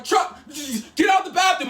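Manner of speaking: high, loud retold protest; acted-out flustered shout; alarmed yelling. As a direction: yell at someone to get out. Roommate calls out from the toilet.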